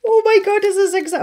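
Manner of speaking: high, loud delighted squeal; excited squeal. Real, not acted. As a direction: gush over something adorable. Delighted at tiny book.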